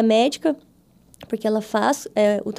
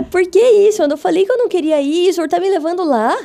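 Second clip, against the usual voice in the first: higher, louder, quick protesting question; mock indignant protest; 6 semitones higher, 5 dB louder, faster than usual. Telling God he is taking her back there, mock indignant. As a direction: complain to the Lord in mock outrage. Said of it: "Eu olhei e falei".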